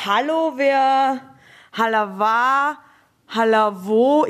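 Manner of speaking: "Hallo wär, Halawa, Halavo" spelled out slowly, syllable by syllable; "Halawa" drawn out very slowly with extra syllables added; secret language demo. Shown as a demonstration. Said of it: "ein La dranhängen und dann ein Wa".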